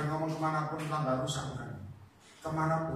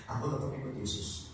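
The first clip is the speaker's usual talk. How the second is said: gentle but firm; 6 semitones lower, 9 dB quieter than usual. Shown as a demonstration. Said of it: "Satunya lemah lembut".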